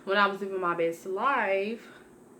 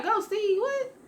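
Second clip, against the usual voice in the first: incredulous; slower than usual. Retold or imitated. Echoing his calm advice back at him, shrill disbelief. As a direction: voice leaps far higher in disbelief. I was going crazy.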